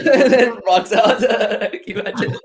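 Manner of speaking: high, laughing through the words. Real, not acted. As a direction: crack up while telling it. Laughing at own joke.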